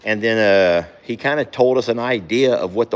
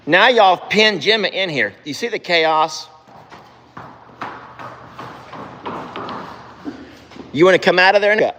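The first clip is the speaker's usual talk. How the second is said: scolding; 7 semitones higher than usual. Real, not acted. Chasing horses out.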